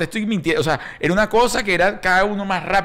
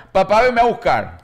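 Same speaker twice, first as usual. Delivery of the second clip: mild mock child's cry; 4 semitones higher than usual. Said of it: "empieza a gritar desde allá".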